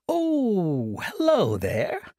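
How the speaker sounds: lilting high then slow hello; sing-song. Acted. Monk greeting Desdemonia, sing-song. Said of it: "in a sing-song voice".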